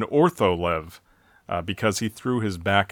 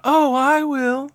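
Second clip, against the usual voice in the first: high squeaky little voice; 4 dB louder, slower than usual. Retold or imitated. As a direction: pipe it up thin and squeaky. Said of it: "a kind of slight squeaky voice".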